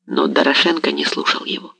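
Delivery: usual speaking voice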